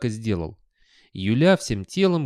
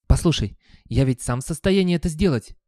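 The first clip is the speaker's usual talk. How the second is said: higher, pleading objection; desperate protest; 5 semitones higher, 5 dB louder than usual. A narrator's reading. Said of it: "в отчаянии проговорил Гаспод".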